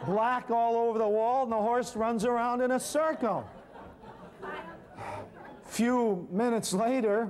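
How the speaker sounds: can barely keep from laughing as the story gets absurd; laughing while recounting the messy horse. Real, not acted.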